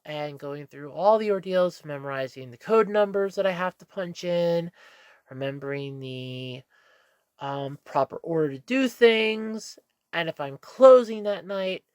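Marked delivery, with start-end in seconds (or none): none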